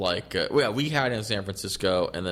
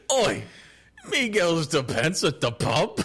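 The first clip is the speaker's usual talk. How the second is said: laughing, hammed-up British accent, a little higher; mock British accent; 6 semitones higher, slower than usual. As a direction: send up a British bloke at the pub. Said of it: "British alternative".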